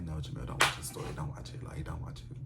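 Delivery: low, hushed self-talk fading to near whisper; quiet acted inner voice; warning oneself. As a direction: talk yourself out of it under your breath.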